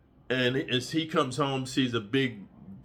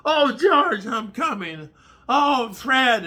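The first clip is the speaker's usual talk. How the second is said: high, loud put-on cries; hammed-up ecstatic calling out; mock woman's voice; 11 semitones higher, 7 dB louder, much slower than usual. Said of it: "accidentally calls him another dude's name".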